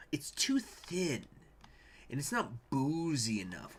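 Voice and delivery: slow, whispered judgment on the beer; whispered verdict. Real, not acted. Tasting a stout on stream, slow whisper. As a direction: breathe it out like a disappointed taster.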